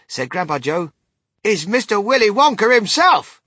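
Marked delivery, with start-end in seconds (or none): 1.4-3.4 high, loud, triumphant reveal; grand, excited introduction; proud announcement. A narrator's reading. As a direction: proclaim the name to the room.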